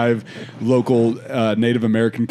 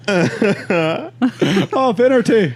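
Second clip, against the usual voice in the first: mock Irish exclamation; 13 semitones higher, slower than usual. Acted Irish comic reaction, laughing.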